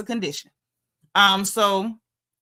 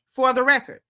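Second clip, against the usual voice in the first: ordinary delivery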